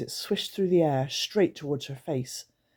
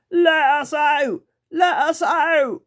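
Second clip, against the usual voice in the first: slow, much higher, loud creature croaking; croaked; 11 semitones higher, 9 dB louder, slower than usual. Acted. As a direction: creatures trapped under the basket. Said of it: "the creakers croaked".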